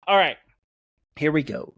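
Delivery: normal talking voice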